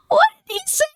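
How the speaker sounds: jumps way up high in disbelief; a sudden high yelp of shock; shocked squeak. Real, not acted. Gamer reacting to what Justin just typed, shrieking in disbelief.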